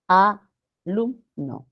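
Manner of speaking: slow "alumno" as a, lum, no after a break; "alumno" cut off from ex, in separate beats; wrongly split syllables. Shown as a demonstration. Teacher shows the incorrect division, split syllables. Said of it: "Este es incorrecto".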